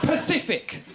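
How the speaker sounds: Pacific, said with a clear P instead of specific; mock the wrong Pacific; mispronounced Pacific. Shown as a demonstration. To the comedy crowd, repeating Pacific for specific. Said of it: "you mean specific".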